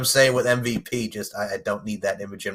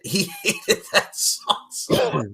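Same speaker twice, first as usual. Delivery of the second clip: high, slow words through laughter; laughing; 8 semitones higher, slower than usual. Real, not acted. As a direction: squeeze it out while cracking up. Cracking up over the hated song.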